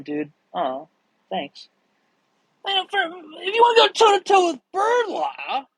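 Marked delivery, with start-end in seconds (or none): 2.7-5.7 strained high-pitched shouting impression; badly done, screechy high shout; Charlie Kelly. Streamer attempting a TV character for viewers, hammed-up impression. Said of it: "that was Charlie Kelly".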